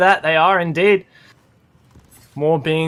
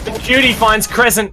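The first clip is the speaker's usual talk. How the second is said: esports caster; 5 semitones higher, 4 dB louder than usual. Real, not acted. Live play-by-play.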